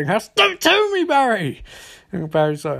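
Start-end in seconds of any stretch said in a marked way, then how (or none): 0.4-1.6 frantic outburst. Retold or imitated. Harrison cuts Barry off, mock frantic. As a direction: wail it over the other person, pitch falling at the end.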